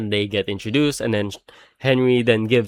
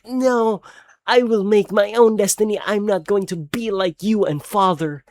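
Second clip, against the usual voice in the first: higher, lightly voiced heroine defiance; mock dramatic vow, pitched up; defiant Vanessa; 8 semitones higher than usual. Voicing Vanessa defying Michael, mock-dramatic and higher.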